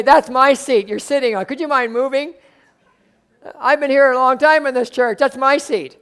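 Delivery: mock the member who guards his seat; mock possessive saint. Preacher mimics possessive church members.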